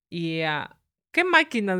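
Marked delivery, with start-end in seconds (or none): 1.1-1.8 high, puzzled echo of the nurse; incredulous question. Retold or imitated. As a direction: toss the question back in disbelief. Nurse's puzzled retort, retold. (pregunta incrédula, aguda)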